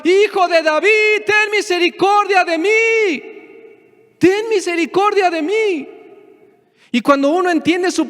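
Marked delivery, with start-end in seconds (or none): 0.1-5.9 high, desperate plea; crying out for mercy. Retold or imitated. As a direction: cry it out, begging. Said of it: "Estos ciegos gritaban".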